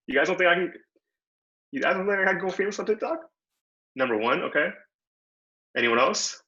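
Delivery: ordinary talk, nothing marked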